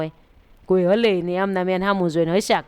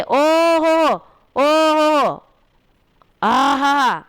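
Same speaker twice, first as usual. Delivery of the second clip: high, loud cries of rapture; ecstatic loud cries; 5 semitones higher, 4 dB louder, much slower than usual. Retold or imitated. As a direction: shout out in wonder at the divine light. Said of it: "રાયડું પાડી પાડીને બોલવા માંડ્યા".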